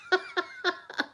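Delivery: high, light laugh; a soft high giggle; real laugh. Real, not acted. Chatting about the kitchen roof, laughing lightly.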